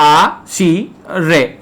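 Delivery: आ, शी, रे as separate, held sounds; syllable by syllable. Shown as a demonstration. Teacher showing where रे is pronounced, slowly syllable by syllable. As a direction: break it slowly into "आ… शी… रे". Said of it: "ये रे का उच्चारण हुआ".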